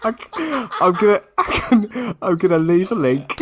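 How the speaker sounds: real giggling defeat; laughing, giving up. Real, not acted. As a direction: surrender to laughter. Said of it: "gives up laughing".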